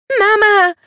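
put-on very high, loud child's scream for her mother; a girl shrieking in terror. A narrator's reading. The girl screaming for her dying mother: high loud scream. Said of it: "schrie das Mädchen".

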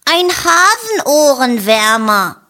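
high, lively little bunny voice; Ferdinand voice. A narrator's reading. To his friends about the ear warmer, high and pleased. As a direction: chirp it out brightly.

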